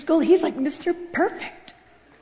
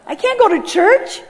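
high, louder, drawn-out protest; alarmed protest; 8 semitones higher, 5 dB louder than usual. Retold or imitated. Balking at God's idea of church, high mock-horrified. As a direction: wail it in mock alarm, pitched up. Repeats refusal, now in open alarm.

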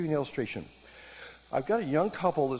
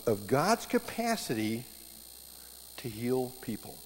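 ordinary delivery

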